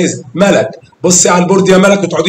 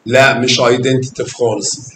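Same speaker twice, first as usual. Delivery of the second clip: normal talking voice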